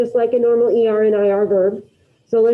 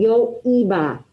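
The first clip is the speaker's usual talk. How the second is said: slow model for repeating; slower than usual. Shown as a demonstration. Teacher to class, modeling slowly for repetition. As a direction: say "yo iba" slowly and clearly for the class to copy. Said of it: "So, so repitan".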